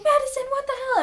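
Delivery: high-pitched imitation of shocked concern; mock alarmed dismay. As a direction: act out her dismay way up high. Zoe finds Madison after the assault.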